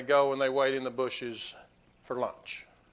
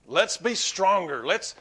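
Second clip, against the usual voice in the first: usual speaking voice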